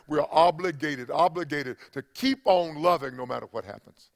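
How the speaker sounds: loud, fervent preaching. Acted. Pastor preaching to his church in full fervour.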